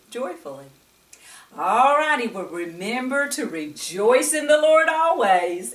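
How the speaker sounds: plain reading voice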